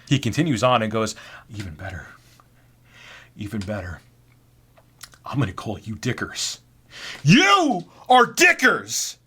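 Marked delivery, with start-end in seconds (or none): 1.5-6.6 hushed, halting pronouncement; odd coworker scheming. Acted. Weird coworker inventing a nickname. 7.2-9.2 slow, high-pitched scream. Acted. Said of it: "screaming Dickers and Dick".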